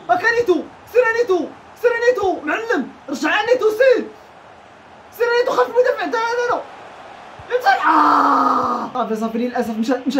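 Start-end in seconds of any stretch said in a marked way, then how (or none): 0.1-6.6 high, loud urging calls; urging shouts. Real, not acted. Gamer urging his player forward, high loud calls. 7.5-8.9 loud drawn-out yell; shout. Real, not acted. Gamer yelling at his defender, loud drawn-out shout.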